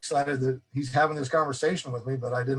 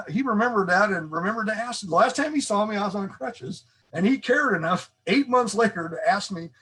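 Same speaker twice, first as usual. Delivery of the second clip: ordinary talk, nothing marked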